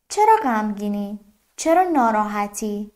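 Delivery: ordinary talk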